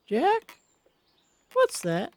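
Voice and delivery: put-on high, soft old woman, suddenly uneasy; frail wife noticing something wrong; alarmed old Molly. Wife points out the strange cloud, high and uneasy.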